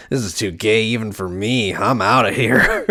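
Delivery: hammed-up, laughing impression building loud and high; mock exit line. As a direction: play a fed-up character storming off, laughing. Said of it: "Samuel's like".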